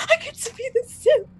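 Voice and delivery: high-pitched giddy squeal of words; thrilled, high little outburst; excited. Retold or imitated. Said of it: "I was so excited".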